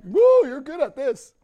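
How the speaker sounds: loud, high impressed exclamation; impressed whistle. Retold or imitated. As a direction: sound amazed at the record. Officer reading rap sheet.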